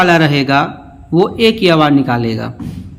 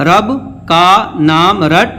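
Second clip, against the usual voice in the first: "رب کا نام رٹ" with a gap after "رب"; "رب", pause, then "کا نام رٹ" spaced out; word-by-word reading. Shown as a demonstration. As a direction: separate each word of "رب کا نام رٹ" for learners. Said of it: "اب اس کو روانے پڑھتے ہیں".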